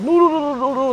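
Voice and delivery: high, loud panicked "no"; mock alarmed no. Instructors stopping a kid at the machine gun, a loud high no. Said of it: "they're like".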